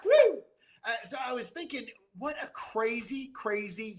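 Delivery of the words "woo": high, loud celebratory woo; cheering whoop. Real, not acted. Auctioneer whooping for his team, high cheer. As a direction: whoop like at a ballgame.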